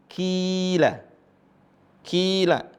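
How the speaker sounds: "qila" with a drawn-out first vowel; long i. Shown as a demonstration. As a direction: hold the i in "qila". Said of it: "sebelumnya kasrah". ("qila" dengan i dibaca panjang)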